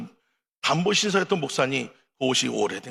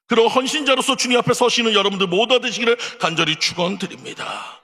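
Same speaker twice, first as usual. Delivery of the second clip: loud, high pulpit benediction; fervent blessing; 8 semitones higher, 6 dB louder than usual. Acted. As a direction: pronounce the blessing with full force. Closing blessing of sermon.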